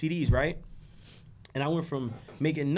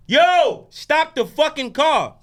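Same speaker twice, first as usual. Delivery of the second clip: louder and higher, stop dragged out slow; higher, louder urgent command; urgent warning; 9 semitones higher, 7 dB louder, much slower than usual. Retold or imitated. Voicing God's warning in car.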